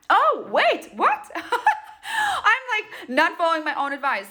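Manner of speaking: sudden giggly self-correction; laughing at herself. Real, not acted. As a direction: laugh as you catch your own mistake. Catches herself mid sign-off.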